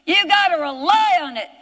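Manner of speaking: insistent, rhythmic pulpit exhortation; impassioned preaching. Real, not acted. Preacher urging congregation to rely on it for everything, insistent exhortation. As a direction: punch the key word of every phrase.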